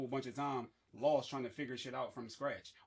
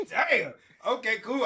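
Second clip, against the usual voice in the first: laughing; 7 semitones higher, 9 dB louder, slower than usual. Real, not acted. Laughing at heavy question.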